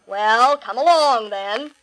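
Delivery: loud, slow big-goat challenge; crying out. A narrator's reading. Biggest goat daring the troll, crying out. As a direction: call it out firmly, taking your time. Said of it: "cried the great big billy goat Gruff".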